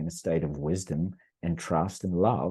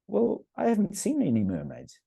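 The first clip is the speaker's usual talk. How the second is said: imitating his wife; 13 semitones higher than usual. Wife answering daughter.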